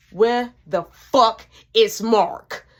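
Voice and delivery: slow, loud angry demand; mama demanding. Retold or imitated. Mama demanding where Mark is, slow and loud. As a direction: drag out every word in anger.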